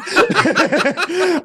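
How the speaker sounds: high, loud burst of laughter. Real, not acted. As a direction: crack up at your own story. Cracks up at own retold story.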